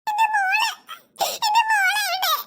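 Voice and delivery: high-pitched, tearful panic; frantic mother. Acted. Mother searching for her missing child, panicked. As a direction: call out desperately, near tears.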